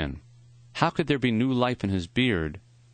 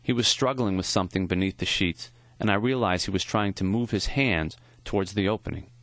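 plain reading voice

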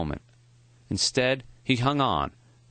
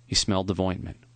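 ordinary delivery

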